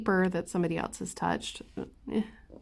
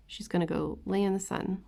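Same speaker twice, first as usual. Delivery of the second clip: normal talking voice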